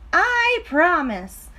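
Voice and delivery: high, slow sweet promise; slow pigtail promise. Acted. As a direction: drag it out to sound trustworthy. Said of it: "said the pigtail".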